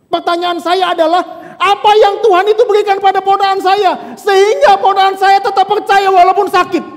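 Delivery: high and loud urgent plea; impassioned question. Retold or imitated. Pastor voicing the uncle asking about his nephew's faith, impassioned loud question.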